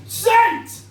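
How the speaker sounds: high-pitched comic blast; sudden assault. Acted. Walking into Lush and getting hit by the smell, high sudden blast. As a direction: burst out high, like being hit by a wall of smell. Smell hits on entering shop.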